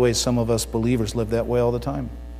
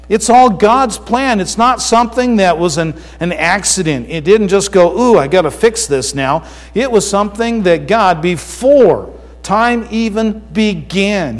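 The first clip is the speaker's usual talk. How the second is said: loud, raised, emphatic preacher; forceful preaching; 7 semitones higher, 6 dB louder than usual. Real, not acted. Pastor telling the congregation of God's plan, forceful preaching. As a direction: proclaim it with weight on the key words.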